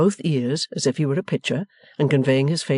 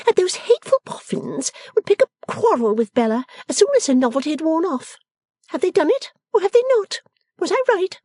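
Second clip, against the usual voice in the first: high-pitched, pert challenge; triumphant Lavvy; 14 semitones higher than usual. A narrator's reading. Miss Lavvy taunting Bella about the Boffins, triumphant. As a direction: demand an answer in a high voice.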